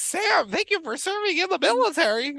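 high-pitched mock wrestler voice; squeaky, put-on sincerity; Mankind. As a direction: thank him in a silly high impression. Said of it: "He sounded like Mister Bill when he talked".

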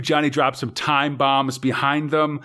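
ordinary talk, nothing marked

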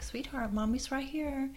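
put-on high, gentle coaxing to a toddler; mother calling her hurt toddler, high and soothing; soothing mommy voice. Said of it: "I'm like".

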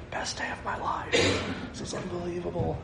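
mock-thrilled, hushed and very soft; hushed elation. Preacher imagining himself walking on the water, hushed elation. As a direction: marvel under your breath, as if imagining it.

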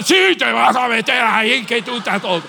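loud, laughing insistence; mock the stubborn grandpa; grandfather insisting. Grandpa ordering the boy onto the ride, loud and laughing. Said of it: "mi padre es igual".

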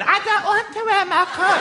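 put-on high refusing kid voice; whiny child. Son refusing his coat, high child voice. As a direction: play the sulky little boy.

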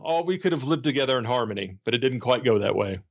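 usual speaking voice

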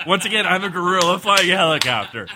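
loud, hammed-up helicopter traffic report; radio traffic reporter. Gorilla traffic reporter continues.

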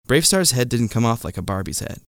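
ordinary delivery, nothing marked

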